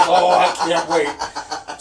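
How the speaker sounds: slow, loud, laughing sarcasm; loud mock eagerness through laughter; sarcastic. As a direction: drag it out, mocking.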